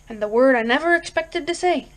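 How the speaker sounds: ordinary delivery, nothing marked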